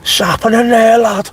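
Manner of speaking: Scouse shout. Real, not acted. Back home in Liverpool, calling out in Scouse. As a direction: belt it out in thick Scouse. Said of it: "I think my real accent comes out".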